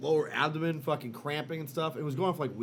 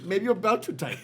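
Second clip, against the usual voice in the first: put-on Indian accent; 5 semitones higher, 5 dB louder than usual. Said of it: "Your impression of Indian guys".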